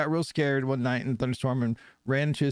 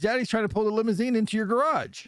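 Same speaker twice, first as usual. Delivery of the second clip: put-on high, urgent child's warning; acted kid voice, pitched up throughout; little boy; 7 semitones higher than usual. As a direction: warn Mommy like a small boy delivering the punchline. Joke's boy warning mother, punchline.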